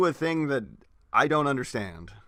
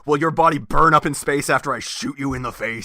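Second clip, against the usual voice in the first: mock-earnest hero asking, a bit louder; 3 semitones higher, 7 dB louder than usual. Brother mocking Optimus's pointless question, mock-earnest. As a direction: ham up the polite hero question. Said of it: "It's like".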